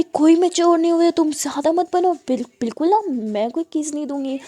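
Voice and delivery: mother loudly scolding her daughter for claiming maturity; angry scolding. Acted. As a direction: bark that she's no grown-up. Mother scolding, refusing again.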